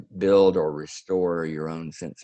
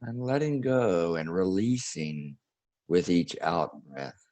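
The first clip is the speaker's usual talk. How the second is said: guided meditation; 2 semitones higher than usual. Acted. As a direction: ease the listeners into breathing and release.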